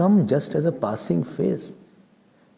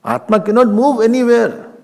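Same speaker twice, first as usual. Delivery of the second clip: usual speaking voice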